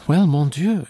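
high, loud exclamation; lively heroine's outburst; exclaiming. A narrator's reading. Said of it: "she exclaimed".

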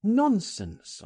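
high, exclaimed dismissal; dismissive cry. A narrator's reading. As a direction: wave the worry away with a cry. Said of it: "I cried".

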